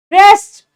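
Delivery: रेस्ट said loudly with a firm rolled र; strong r. Shown as a demonstration. Teacher showing the strong starting r. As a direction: hit the र of रेस्ट hard. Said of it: "आर पे focus हो रहा है".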